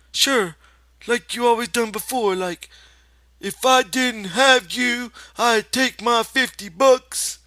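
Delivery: put-on high, childlike confession; Lenny confessing. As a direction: admit it like a guilty child. Lenny quoting George.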